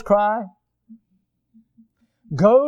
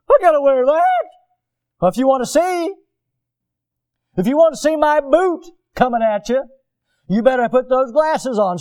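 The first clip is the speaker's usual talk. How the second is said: raised, high-pitched mock exchange; acted confrontation; 7 semitones higher, 4 dB louder than usual. Preacher acts out sergeant forcing ugly glasses on recruit, raised voice. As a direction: act out a heated boot camp threat.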